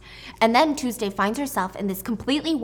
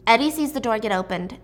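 normal talking voice

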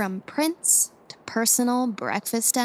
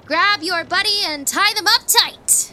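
louder, higher, gleeful order; 6 semitones higher, 6 dB louder than usual. Acted. Ordering the crew to tie each other up, gleefully loud. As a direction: relish every word of the command. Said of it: "No need to sound so gleeful about it".

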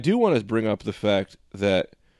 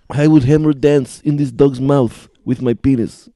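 mock French; 8 dB louder, slower than usual. Doing a French dialect bit.

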